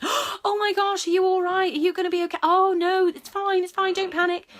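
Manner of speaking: very high, loud, frantic fussing over the dog; hammed-up panic, gasping and fretting; panicky over-fussing. Shown as a demonstration. Owner overreacting to her scared dog, shrill and panicked. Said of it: "making the fear worse by going".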